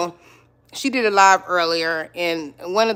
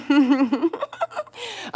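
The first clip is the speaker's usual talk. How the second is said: high giggle, falling away; embarrassed laugh; 9 semitones higher than usual. Real, not acted. Laughs after the phone interruption.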